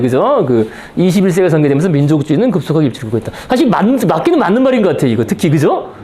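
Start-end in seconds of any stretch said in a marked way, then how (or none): none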